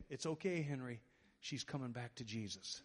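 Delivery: low, soft voice of a reassuring dad; calm reassurance. Retold or imitated. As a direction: quietly settle a startled son. Father reassures startled son.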